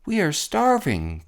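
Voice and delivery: a light groan of a crushed turtle. A narrator's reading. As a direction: Mac begging the king from below. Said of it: "groaned Mac".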